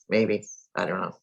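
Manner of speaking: ordinary delivery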